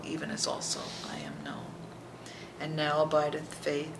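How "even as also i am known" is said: softer whisper full of wonder; awed hushed whisper. Real, not acted. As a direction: whisper it in awe.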